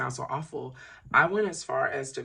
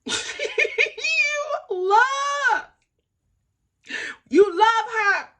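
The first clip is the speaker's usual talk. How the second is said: very high, loud squeal between laughs; laughing teasing shout; 8 dB louder, slower than usual. Retold or imitated. Voicing the music app's yearly review, squealing with laughter.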